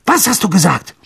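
angry question thrown at a servant; fauchend. A narrator's reading. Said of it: "fauchte Mister Malfoy". (scharf und gereizt nachgefragt)

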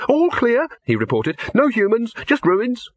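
squeaky little rabbit reporting back; high bunny voice. Acted.

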